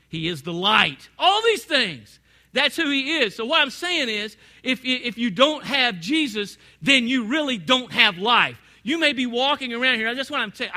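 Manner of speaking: full-voiced preacher build; preaching at full force. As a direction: press the point home to the congregation. Preaching climax.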